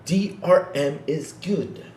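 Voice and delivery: high, halting imitation of a slow speaker; mock slow talker. Mimicking the Dreamcast guy who called him slow, exaggerated slow talk. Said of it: "Yeah, he talks, though".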